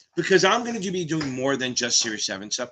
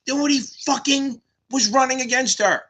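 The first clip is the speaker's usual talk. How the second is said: higher-pitched indignant rant; exasperated outburst; 8 semitones higher than usual. Real, not acted. Streamer fed up with a political story, exasperated outburst. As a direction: cut the topic off in exasperation.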